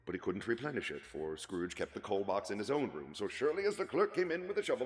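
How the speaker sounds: plain reading voice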